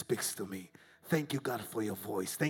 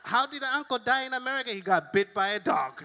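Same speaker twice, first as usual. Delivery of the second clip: mock relatives' exchange; 10 semitones higher, 11 dB louder than usual. Imagined relatives talking.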